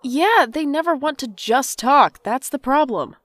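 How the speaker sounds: snapping back at her sister about their parents; angry outburst. Acted. Yelling at sister about their parents, angry.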